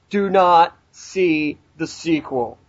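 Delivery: very slow, each word spaced; slow deliberate warning. Retold or imitated. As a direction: drag out "Do not see the sequel" as a solemn warning. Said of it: "and you ask".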